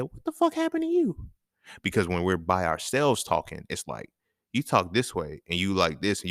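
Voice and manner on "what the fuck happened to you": high-pitched mock disbelief; retold shocked question, pitched way up; incredulous self-confrontation. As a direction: confront yourself in a much higher voice. Retells confronting himself in the mirror.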